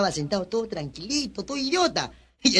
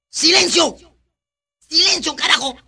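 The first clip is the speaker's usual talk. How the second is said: loud, high barked command; acted shout; 7 semitones higher, 6 dB louder than usual. Ex-convict silencing a rowdy class, acted angry shout. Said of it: "se para en el medio del aula y grita".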